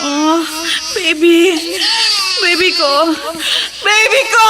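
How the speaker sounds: high, loud wailing cry in a nightmare; desperate sobbing yell from a bad dream; crying shout. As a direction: scream out for the lost baby, sobbing. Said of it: "sumisigaw ka na naman".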